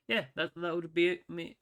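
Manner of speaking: tripping over the words; stumbling through the sign-off; fumbling for words. Real, not acted. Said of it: "Sorry, I'm stumbling already".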